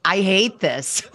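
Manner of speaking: blunt disdain. Retold or imitated. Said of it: "I was just like".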